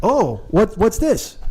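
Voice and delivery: mock a small boy's puzzled question; curious kid. Six-year-old examining the bare microphone, lightly imitated curious kid.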